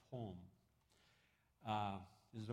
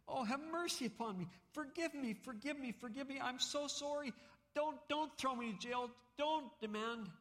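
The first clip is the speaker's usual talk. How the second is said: high, begging voice, much higher; pleading debtor; 3 dB louder than usual. Acted. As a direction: beg desperately for forgiveness. Debtor begs for mercy.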